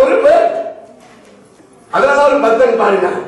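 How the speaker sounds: ordinary talk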